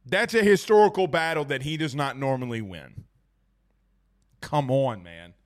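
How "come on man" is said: usual speaking voice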